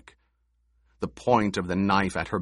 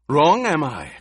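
higher, louder challenge; demanding; 7 semitones higher, 7 dB louder than usual. A narrator's reading. Gurney challenging Paul, angry demand. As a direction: throw it back at him. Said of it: "Gurney demanded".

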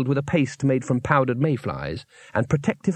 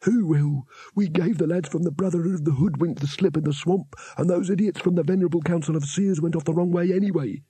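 higher, scornful wizard; another wizard; 4 semitones higher than usual. A narrator's reading. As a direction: dismiss the worry with a higher voice. A second wizard answers.

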